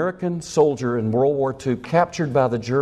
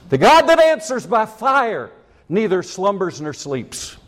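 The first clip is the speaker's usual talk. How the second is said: preaching proclamation; 6 semitones higher, 3 dB louder than usual. Acted. Preaching scripture slowly.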